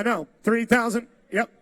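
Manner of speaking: ordinary talk, nothing marked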